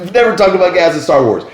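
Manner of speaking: loud, high outraged objection; ranting. Retold or imitated. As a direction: protest at the film, loudly. Reenacting his rant during the movie.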